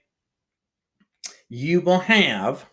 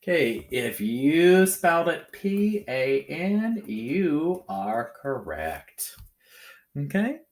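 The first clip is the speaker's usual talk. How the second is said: ordinary delivery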